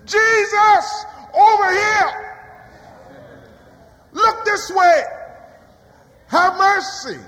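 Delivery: high, loud pleading shout; lepers calling out. Acted. Lepers crying out to Jesus on the road, pleading shout. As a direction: wave him over, desperate. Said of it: "broadcast their predicament".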